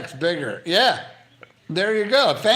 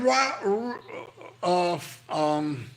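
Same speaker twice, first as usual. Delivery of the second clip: ordinary talk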